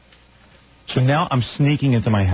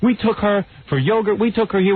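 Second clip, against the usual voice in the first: imitating his wife; 9 semitones higher than usual. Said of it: "She goes".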